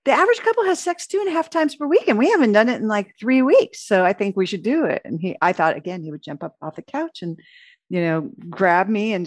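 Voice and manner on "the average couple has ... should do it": higher, fast, bossy stats recital; mock demand, like a scolding parent; lightly voiced nagging wife; hands-on-hips lecture. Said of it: "put my hands on my hips and say".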